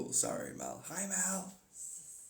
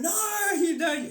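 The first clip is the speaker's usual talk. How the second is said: slow, much higher shouted no; mock stern shout; 14 semitones higher, 5 dB louder, much slower than usual. Host shouting down the players, a mock slow yell. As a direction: overrule them with a drawn-out yell.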